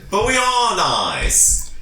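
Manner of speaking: slow, high, drawn-out protest; hammed-up adventurer's plea; pleading character voice. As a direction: protest to the guard theatrically.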